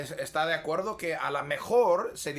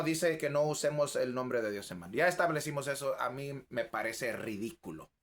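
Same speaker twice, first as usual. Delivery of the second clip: ordinary talk, nothing marked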